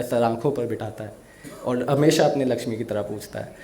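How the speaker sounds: ordinary delivery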